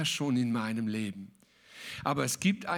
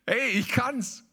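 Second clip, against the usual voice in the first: higher, louder, swaggering boast; playful mock bravado, pitched up; cocky self-quote; 7 semitones higher, 4 dB louder than usual. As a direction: crow about walking on water. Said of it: "Und ich hab gesagt".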